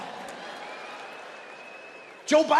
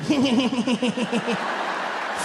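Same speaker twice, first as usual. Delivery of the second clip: chuckly Bush laugh; 2 semitones higher than usual. Retold or imitated. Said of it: "that weird chuckly thing".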